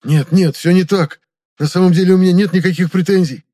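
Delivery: loud, high-pitched protest; panicked, insistent pleading; frantic denial. Acted. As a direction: backpedal desperately at full voice. Captive frantically denies any grievance.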